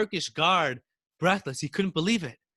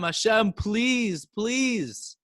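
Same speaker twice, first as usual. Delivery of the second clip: high, tearful pleading; crying and begging; 7 semitones higher, much slower than usual. A narrator's reading. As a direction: beg Hashem through tears. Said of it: "he started crying and begging Hashem".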